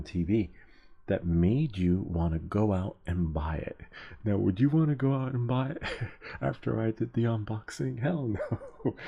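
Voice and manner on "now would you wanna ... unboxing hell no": higher, laughing rhetorical question; amused disbelief. Real, not acted. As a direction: laugh at your own expense, pitched up. Mock question about own unboxing, laughing.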